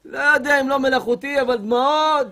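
usual speaking voice

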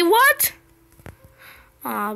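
normal talking voice

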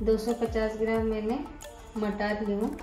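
ordinary talk

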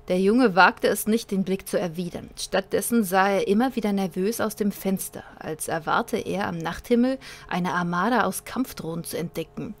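dramatic narrator reading a sci-fi tale; story narration. A narrator's reading. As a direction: read it like an audiobook.

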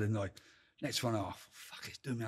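ordinary delivery

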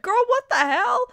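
a stunned "what the hell" at the page; shocked outburst. Real, not acted. Reader stunned by the story, shouting out in disbelief.

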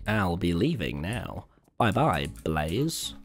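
quiet, drawn-out goodbye; soft slow farewell. Real, not acted. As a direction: say goodbye gently and slowly.

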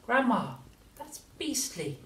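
put-on high, revolted boy; a young boy recoiling; disgusted boy voice. A narrator's reading.

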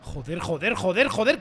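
low, soft repeated swearing; cursing under breath. Real, not acted. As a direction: swear quietly to yourself. Cursing during the play.

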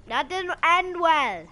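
high, drawn-out wail of dismay; loud lament. Real, not acted. Gamer lamenting a failed move, loud drawn-out wail. Raised repeat of the remark.

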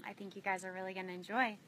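ordinary talk

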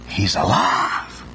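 slow, hushed whisper. Acted. Preacher whispers to the congregation, slow and awed. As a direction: breathe it out in awe.

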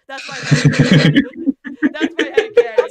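loud, real laughter through the words; helpless laughing at own story. Real, not acted. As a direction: crack up while saying it. Cracks up after own story.

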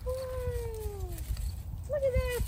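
high excited woo, then marvelling; delighted whoop. Real, not acted. Woman scattering milkweed seeds in the woods, high delighted whoop.

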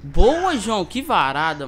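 loud excited cheer. Real, not acted. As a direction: cheer the great shot. Teammate gets a great kill.

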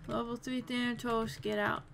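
ordinary talk

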